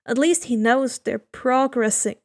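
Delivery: usual speaking voice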